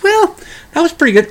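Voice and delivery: hesitant high-pitched reply; high Bob voice. Retold or imitated. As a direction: pipe up with a reluctant yes. Bob voiced in retold story.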